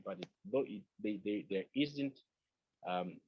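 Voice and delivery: ordinary talk, nothing marked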